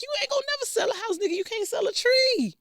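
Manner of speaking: high, fast sassy scolding; sassy mock lecture. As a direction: rattle it off like a diva. Mock lecturing her date.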